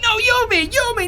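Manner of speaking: very high, insistent little goblet voice; squeaky bickering goblet. Acted. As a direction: squeak back in protest. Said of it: "the other one's gonna be like".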